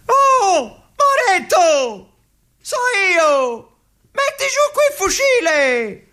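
very high, frantic pleading cry; young soldier crying out, half laughing, to his comrade; desperate call. Acted. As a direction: shout up to the sentry in panic. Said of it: "Il Lazzari".

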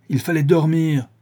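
high, loud, impatient comeback; angry retort. A narrator's reading. Sholmes rejects his friend's excuse, angry retort. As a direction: throw it back at him crossly.

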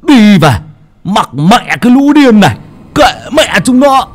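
enraged grandfather storming off; angry shout. Acted. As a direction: bark for the boy, building into high yelling. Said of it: "Ông nội quát".

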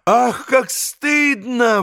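high, drawn-out cry of shame; ashamed outcry. A narrator's reading. Grushenka is shamed by the cheat, high slow outcry. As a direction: raise the pitch and stretch it, mortified. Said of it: "воскликнула Грушенька".

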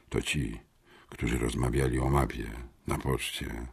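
low, soft near-whisper; hushed aside. A narrator's reading. Syfon nudging his friend about two strangers, hushed. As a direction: murmur it secretly, well under your voice. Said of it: "szepnął".

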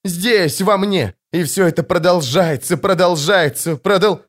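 high, insistent, agitated repetition; verging on hysteria. A narrator's reading. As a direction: spiral upward as if about to break down. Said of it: "он вот-вот сорвётся в истерику".

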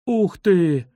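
put-on a mildly awed children's exclamation; awed delight. A narrator's reading. Children see the famous painting, awed delight. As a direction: voice children letting out a delighted wow. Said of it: "восторженно выдохнули дети".

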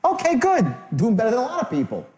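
brightly accepting, not gushing; a little upbeat. Shown as a demonstration. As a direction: lift it just above the client's mood. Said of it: "Little upbeat, not too much".

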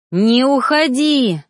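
loud, slow desperate cry; shout. A narrator's reading. Reaper shouting after beloved leaving, loud desperate cry. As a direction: scream after her to stay. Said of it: "закричал синеглазый жнец".

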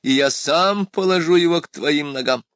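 ordinary delivery, nothing marked

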